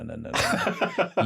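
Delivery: mock-horrified teen, giggling; laughing protest. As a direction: plead while cracking up.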